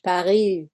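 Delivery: Paris with a French R, silent S. Shown as a demonstration. French speaker showing the interviewer the R, French Paris. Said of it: "rolling the R is very French".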